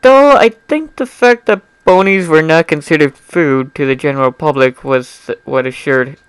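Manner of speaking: ordinary delivery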